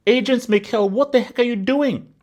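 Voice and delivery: normal talking voice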